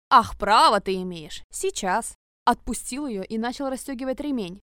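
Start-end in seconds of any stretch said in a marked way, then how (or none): 0.1-2.1 enraged. Acted. Enraged threat.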